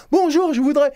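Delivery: high, strained words on a gasping inhale; talking while inhaling. Shown as a demonstration. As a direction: speak while sucking air in. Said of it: "vous parlez en inspirant".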